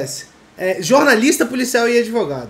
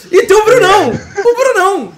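laughing; 9 semitones higher, 6 dB louder than usual. Real, not acted. Laughing as he names Brunão.

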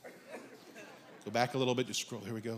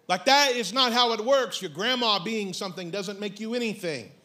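ordinary talk

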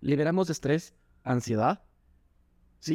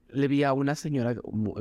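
ordinary delivery

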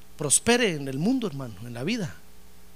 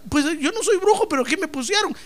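high, anxious protest; a hint of a scared Daniel; pleading denial; 10 semitones higher than usual. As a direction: insist nervously you're not a witch. Daniel denying he's a witch.

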